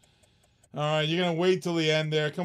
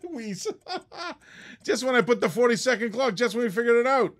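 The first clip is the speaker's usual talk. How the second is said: talking through laughter; laughing; 7 semitones higher, 4 dB louder than usual. Real, not acted. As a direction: laugh while you complain. Laughs through exasperated remark.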